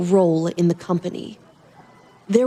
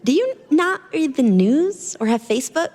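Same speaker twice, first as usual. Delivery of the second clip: put-on higher, light young woman's voice; girl's voice; 6 semitones higher than usual. Girl teasing Adrian about the toilet paper, light young voice. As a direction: voice the cheeky girl in the store.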